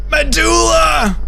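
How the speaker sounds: long, high scream of the word; high drawn-out scream of a captive; desperate screech. Acted. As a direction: shriek it with everything left. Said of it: "I screeched as loud as I could".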